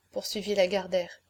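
plain reading voice

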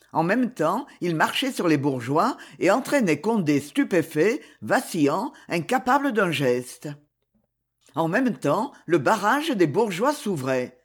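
ordinary delivery, nothing marked

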